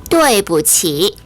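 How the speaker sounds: 对不起 slow and clearly separated; repeat-after-me model. Shown as a demonstration. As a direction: model 对不起 syllable by syllable for learners, drawn out. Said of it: "원어민의 발음을 잘 듣고 따라해 볼까요".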